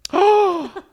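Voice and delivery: loud, high-pitched gasp; big high intake of breath; excited gasp. Real, not acted. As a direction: gasp loudly at a brilliant idea. Struck by the mukbang idea.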